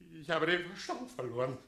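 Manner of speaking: manic outburst. Acted. Mayor playing madness.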